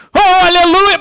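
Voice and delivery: high, loud hammed-up hallelujah; big brother's hallelujah. Preacher acting the tall brother shouting praise, loud hammed-up cry. O irmão grandão.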